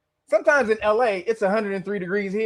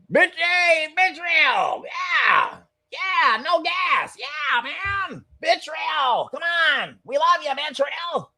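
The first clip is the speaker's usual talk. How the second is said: mock cheering; 8 semitones higher, much slower than usual. Mocking Israel's fans, shouting high mock cheers. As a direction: ham it up like a cheering fan.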